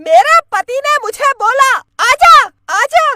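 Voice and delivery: high, loud witch voice; high-pitched witch declaiming; menacing witch. Acted. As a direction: address the couple as a theatrical witch. Witch tells her story.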